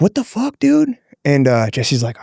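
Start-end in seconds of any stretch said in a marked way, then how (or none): 0.0-1.0 outraged shout. Retold or imitated. Outraged at ref from corner.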